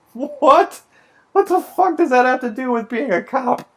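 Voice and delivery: high, squeaky disbelief with a laugh; what on earth; incredulous. Real, not acted. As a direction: blurt it out in laughing outrage. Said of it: "reacting to absurd test question".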